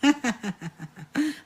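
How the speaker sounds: high breathy laughing fit; helpless laugh. Real, not acted. Host laughing at viewer's thrown-away scraps, helpless laughter. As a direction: laugh till it hurts. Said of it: "I'm crying".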